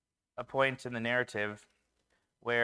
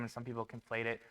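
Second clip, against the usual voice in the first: usual speaking voice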